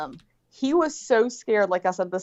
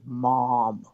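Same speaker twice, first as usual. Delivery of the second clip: drawn out "Mom", lower; slow "Mom"; 8 semitones lower than usual. Retold or imitated. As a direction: stretch "Mom" out slowly like a scared kid. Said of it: "very slowly".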